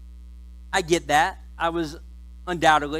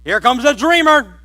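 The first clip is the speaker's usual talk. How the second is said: mocking brothers; 5 semitones higher, 8 dB louder than usual. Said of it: "They're like".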